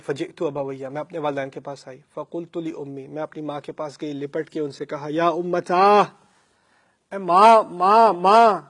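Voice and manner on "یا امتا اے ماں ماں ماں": crying out to mother. A narrator's reading. Daughter clinging to her mother, crying out loud. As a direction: wail for her mother through tears. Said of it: "رو رو کر میں نے کہا".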